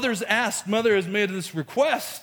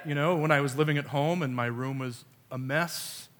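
usual speaking voice

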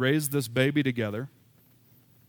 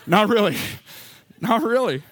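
high, loud, laughing-off retraction; loud joking denial; 8 semitones higher, 7 dB louder than usual. Real, not acted. Pastor shouting to congregation to take back his wish, loud and high. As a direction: shout it out to undo the joke.

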